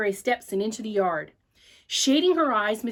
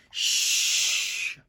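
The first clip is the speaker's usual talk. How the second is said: librarian shush. Acted. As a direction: shush the noisy boy. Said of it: "finger against her lips".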